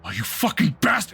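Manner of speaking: loud, high-pitched furious cursing; acted furious yell; enraged outburst. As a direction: explode in rage on waking. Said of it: "he's like".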